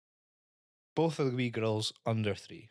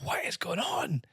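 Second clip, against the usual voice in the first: slow, high whisper; mock bewildered whisper; whispered bafflement; 13 semitones higher, slower than usual. Baffled adult aside.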